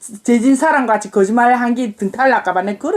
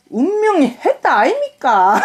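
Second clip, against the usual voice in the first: Gyeongsang dialect; 3 semitones higher than usual. Shown as a demonstration. Mother translates a death notice for her daughter, Gyeongsang dialect. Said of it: "경상도".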